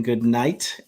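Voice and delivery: ordinary talk, nothing marked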